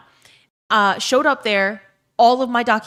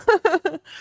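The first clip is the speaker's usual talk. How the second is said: high-pitched real laugh; bubbling run of high laughter; 10 semitones higher than usual. Real, not acted. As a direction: laugh at your own joke, pitched up. Laughs at own joke.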